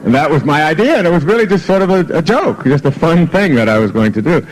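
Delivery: high, giggling through the words; bubbling amusement; amused laughter. Real, not acted. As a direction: laugh it out at your own joke. Laughing at his own joke book.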